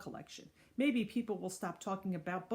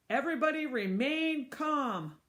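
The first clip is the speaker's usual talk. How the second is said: louder, higher call; hollered; 6 semitones higher, 5 dB louder than usual. Acted. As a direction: holler over the chaos. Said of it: "hollered Miss Sue".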